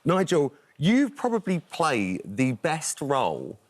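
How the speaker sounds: ordinary talk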